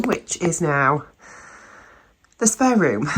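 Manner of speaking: ordinary delivery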